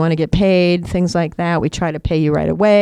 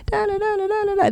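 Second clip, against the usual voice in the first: mock babble; 3 dB quieter, faster than usual. Imitating rhythm of dad's talk.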